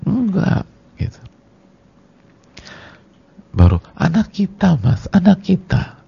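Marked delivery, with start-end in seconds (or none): 0.1-0.6 high whimpered no; mock tearful denial. Wife denying a quarrel to her husband, mock whimper. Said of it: "nangis lagi gitu loh". 4.0-6.1 high, slow pretend crying; mock tearful wailing. Wife finally telling her husband about their child, mock wail. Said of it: "saya nangis depan dia".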